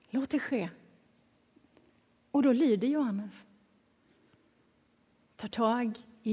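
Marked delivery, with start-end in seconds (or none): 0.1-0.7 quiet, settled retold reply; soft reassurance. Jesus answers the panicked John, soft and calm. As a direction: answer the fluster softly.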